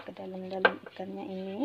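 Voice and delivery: normal talking voice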